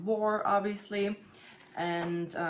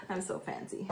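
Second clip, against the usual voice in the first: mock-posh self-tease; 7 dB quieter than usual. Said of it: "Just kidding".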